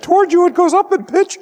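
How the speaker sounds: "Toward you" sung way up high like an approaching siren; high pitch demo. Shown as a demonstration. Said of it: "that's the Doppler effect, slightly exaggerated".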